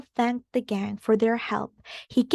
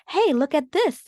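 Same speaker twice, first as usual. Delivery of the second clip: excited exclaim; 6 semitones higher, 4 dB louder than usual. Acted. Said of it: "exclaimed Velma".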